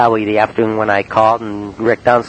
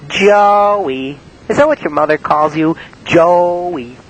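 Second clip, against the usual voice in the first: mock teasing; 7 semitones higher, slower than usual. [name] mocking name.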